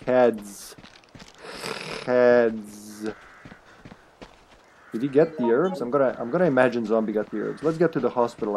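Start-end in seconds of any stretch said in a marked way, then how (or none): 0.0-3.1 drawn-out, deliberate "heads"; mock teaching. Streamer showing Jim which coin side, mock teaching. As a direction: spell it out slowly for a slow pupil.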